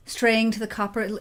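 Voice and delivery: ordinary delivery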